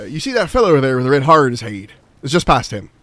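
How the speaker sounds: pirate-like Elizabethan English; hearty Elizabethan accent with hard Rs; semi-Elizabethan accent. Shown as a demonstration. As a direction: give directions in a rhotic, piratical Elizabethan accent. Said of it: "that's Elizabethan. It sounds very much like a pirate".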